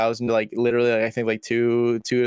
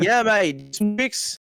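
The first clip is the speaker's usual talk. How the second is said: imitating an Australian bloke, much higher; mock Aussie push, light and quick; Australian accent; 8 semitones higher, slower than usual. The Australian filmmaker insisting they do tricks, mock Australian accent. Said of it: "like, Australian".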